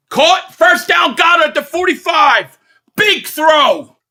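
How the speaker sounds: high, loud thrilled play-by-play yell; excited shout. Real, not acted. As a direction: shout it like the stadium just erupted. Big completion, calling it out.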